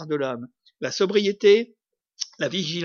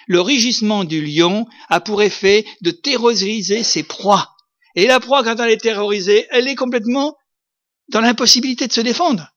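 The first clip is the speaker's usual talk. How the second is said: loud, rising sermon voice about the roaring lion; preaching at full force; 4 semitones higher, 7 dB louder than usual. Acted. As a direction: hammer the terror of the roar home to the congregation. Preaches the terror of the roar. (prédication à pleine voix, de plus en plus forte)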